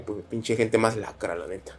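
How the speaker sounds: low, slow, soft disgusted grumble; low muttered disgust. Retold or imitated. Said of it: "que digo".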